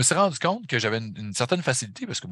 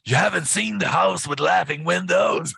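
higher, louder, slowed rocky rasp, grabbing you by the collar; hammed-up rough-voiced metal singer, a touch slow; 7 semitones higher, 4 dB louder than usual. Metalhead grabbing a fan by the collar, mock rough incredulous rasp. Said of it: "avec sa voix rocailleuse".

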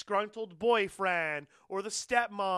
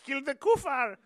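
mock terrorist order; 6 semitones higher than usual. Continues voicing the terrorist.

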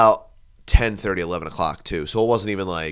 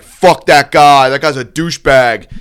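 retold angry insult; 3 semitones higher, 11 dB louder than usual. Singer insulting a friend from the stage, loud angry jeer. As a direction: shout the insult for the whole bar to hear.

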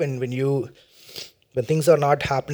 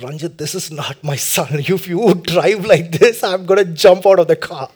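mother's protest; 2 semitones higher, 7 dB louder than usual. Retold or imitated. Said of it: "She said".

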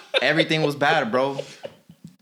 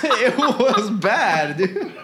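laughing; 5 semitones higher, much slower than usual. Real, not acted. Laughing about bad experience.

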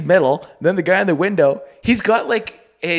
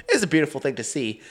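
ordinary delivery

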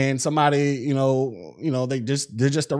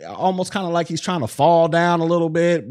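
ordinary delivery

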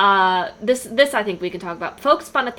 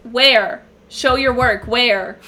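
demanding challenge; 3 semitones higher, 6 dB louder, much slower than usual. Acted. Acting out fans' demand for proof.

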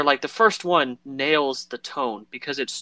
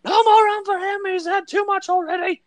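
much higher, louder falsetto landlady; shrill landlady; 4 dB louder than usual. Retold or imitated. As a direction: shout it down like a scolding innkeeper. Innkeeper voiced in retelling.

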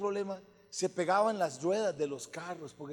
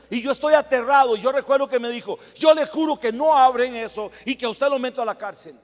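loud, raised-voice threatening quote; retold threat; 6 semitones higher, 14 dB louder than usual. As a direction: re-enact the threat forcefully. Retelling the lawyer's threat.

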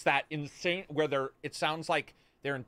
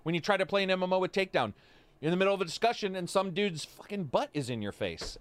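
ordinary delivery

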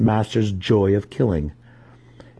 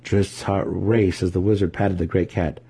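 ordinary delivery, nothing marked

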